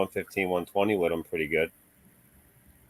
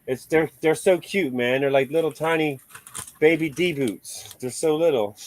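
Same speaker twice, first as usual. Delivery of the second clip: cutesy gushing; 7 semitones higher than usual. Real, not acted. Gushing to his friend over the tiny belted tires, cutesy coo.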